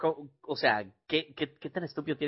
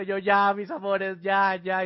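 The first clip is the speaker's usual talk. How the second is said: higher, cooing comfort for the babies; soothing crying babies; 6 semitones higher, slower than usual. Retold or imitated. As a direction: hush the crying little ones gently. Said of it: "llorando una y llorando la otra". (consolando a las bebés con voz aguda)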